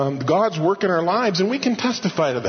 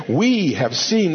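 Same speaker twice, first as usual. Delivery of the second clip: "We" lifted and stressed, slow; slow down and hit "We" in "We have seen"; emphatic stress; slower than usual. Shown as a demonstration. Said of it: "This is emphatic".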